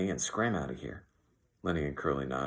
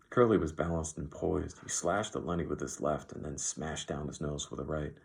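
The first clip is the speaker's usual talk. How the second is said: ordinary delivery, nothing marked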